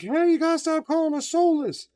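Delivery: a hint of the gingers pleading; mock ginger voice.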